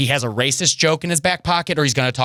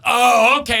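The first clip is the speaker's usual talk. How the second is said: high bro voice; 8 semitones higher, 4 dB louder, slower than usual. Retold or imitated. Fan relieved.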